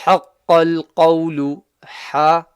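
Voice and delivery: حَقَّ الْقَوْلُ recited with the ha from the middle of the throat. Shown as a demonstration. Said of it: "middle of the throat".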